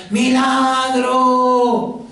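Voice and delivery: drawn-out high cry, very slow; shouting out. Acted. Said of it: "empieza a gritar". (grito prolongado, en tono alto)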